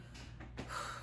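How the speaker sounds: long breathy exhale, acted out; sigh of relief. Nurse picturing her exam result, sigh of relief. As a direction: let out an imagined sigh of relief. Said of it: "get that sigh of relief".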